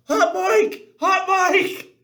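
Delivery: high, loud warning yell; urgent shout. Retold or imitated. Soldier running out of the operations center to warn everyone, shouting. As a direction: yell it out across the camp to cut the broadcast.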